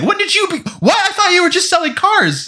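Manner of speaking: high-pitched baffled protest; mock-outraged, voice shooting up; incredulous outburst. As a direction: squeak it out in comic disbelief. Mock outrage at film villain's line.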